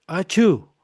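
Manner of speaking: a light acted achoo for the class; teacher playfully sneezing; mock sneeze. Shown as a demonstration.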